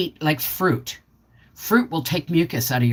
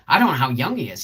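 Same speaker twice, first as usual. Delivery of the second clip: ordinary talk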